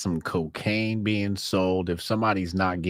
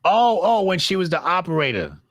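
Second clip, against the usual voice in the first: surprised realization; 10 semitones higher, 3 dB louder than usual. Real, not acted. Sudden recognition of the film.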